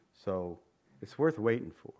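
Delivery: ordinary delivery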